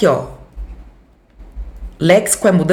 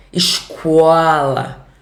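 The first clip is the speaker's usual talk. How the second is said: Escola as iscola, Carioca hissing S, slow; Carioca accent; 2 semitones lower, much slower than usual. Shown as a demonstration. Teacher imitates the Rio de Janeiro way of saying Escola. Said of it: "Ainda bota meio que uma semivogal".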